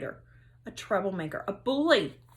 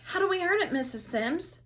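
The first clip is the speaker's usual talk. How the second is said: put-on eager kid; 9 semitones higher than usual. Eager kid asking teacher.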